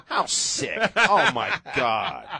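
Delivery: high laughing disbelief; cracking up, slow and loud. Real, not acted. Cracking up at the kid quote.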